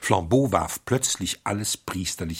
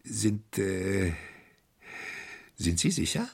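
anxious eagerness. Acted. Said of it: "mit dem ängstlichen Eifer einer naiven Schwankfigur".

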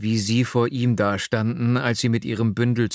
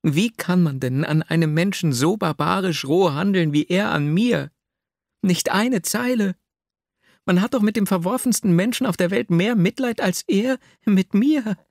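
put-on higher, aggrieved young woman's lament; Nastjenka's voice; 10 semitones higher, 4 dB louder than usual. A narrator's reading. Young woman complains of her cruel lover, higher and hurt. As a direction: plead bitterly in a higher voice.

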